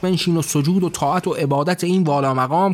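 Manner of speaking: ordinary delivery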